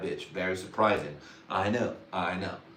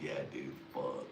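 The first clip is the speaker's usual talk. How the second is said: lower, softer voice; low mutter; 8 semitones lower, 13 dB quieter than usual. Retold or imitated. A guy telling his homie, low and soft. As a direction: grumble it to your homie. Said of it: "This shit, like".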